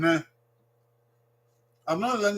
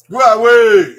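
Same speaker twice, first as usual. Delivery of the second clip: loud called phrase; 5 semitones higher, 13 dB louder, slower than usual. Shown as a demonstration. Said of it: "teacher calls out phrase".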